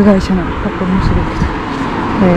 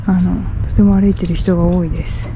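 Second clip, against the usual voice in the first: ordinary talk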